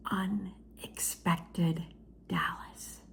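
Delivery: hushed, slow dramatic title; whisper. Acted. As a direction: breathe the title like a secret.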